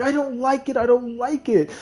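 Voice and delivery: high-pitched upset insisting; whiny kid denial. Retold or imitated. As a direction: plead it like a teased ten-year-old. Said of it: "I was like".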